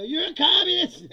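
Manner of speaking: high, loud mocking jab with a laugh; mocking sneer. Streamer mocks a conservative caller, shrill sneering voice. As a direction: sneer it like a cartoon right-winger.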